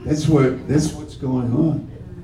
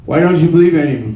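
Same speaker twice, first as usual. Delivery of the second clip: questioner's voice; 6 semitones higher, 5 dB louder, much faster than usual. Acted. Voicing the questioner.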